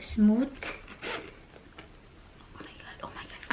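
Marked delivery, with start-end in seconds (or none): 2.5-3.5 breathless whispered disbelief; whisper, lost for words; awed whisper. Real, not acted. Overwhelmed by bag.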